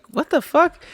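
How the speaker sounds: high-pitched mock bewilderment; baffled reaction. Dad reacting to his baby's noises, baffled. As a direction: squeak out your disbelief, high. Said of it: "I'm just like".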